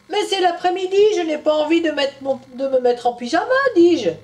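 put-on lively storytelling voice for children; story narration. A narrator's reading. Storyteller telling Tom's story to children, animated. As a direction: read it to little ones.

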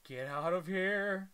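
normal talking voice

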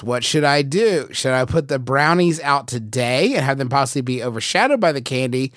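usual speaking voice

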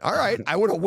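ordinary talk, nothing marked

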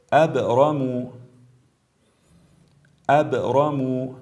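recited ابرم with short echo on ب; brief qalqala. Shown as a demonstration. Tajweed teacher shows letter ب, bouncing it in ابرم. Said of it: "حرف با".

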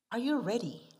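ordinary talk

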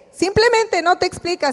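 ordinary talk

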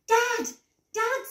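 high-pitched call for Dad. A narrator's reading. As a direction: call urgently, pitched right up. Daisy calls out for Dad.

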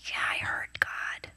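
slow breathy whisper with no voice; slow awed whisper; hushed whisper. Retold or imitated. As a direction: whisper it like a secret. Quoted reply, whispered.

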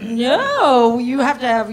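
retold producers overruling her, with emphasis; insistent refusal. Producers overruling an actress on her accent, insistent.

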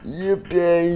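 high, squeaky excited voice; excited Chica. Acted. Chica thrilled to go to Freddy Fazbear's. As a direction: bubble with glee. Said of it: "She was like".

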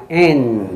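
"N" held through the nose, lips apart; nasal N. Shown as a demonstration. Teacher models the letter through the nose, open-lipped "N". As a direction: end "N" in a nasal hum without closing the mouth. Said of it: "用鼻子发N".

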